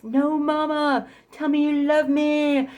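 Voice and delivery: high, slow pleading whine; whiny boyfriend voice. Retold or imitated. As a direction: drag it out like a needy man begging. Imitating boyfriend begging, flipping out.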